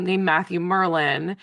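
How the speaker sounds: ordinary talk, nothing marked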